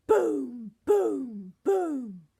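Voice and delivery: loud booming giant steps; thundering giant footfalls; booming footsteps. A narrator's reading. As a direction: pound out each boom. Giant's footsteps shaking room.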